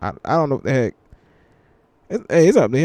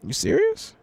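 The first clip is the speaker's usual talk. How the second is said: retold disbelief; 4 dB quieter than usual. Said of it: "I was like".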